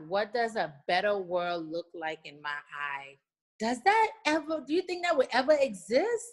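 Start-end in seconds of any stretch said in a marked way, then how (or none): 3.6-6.3 higher, louder, real excitement; light up at the idea, pitch leaping; excited wonder. Real, not acted. Guest imagining a better world that would be awesome, excited wonder.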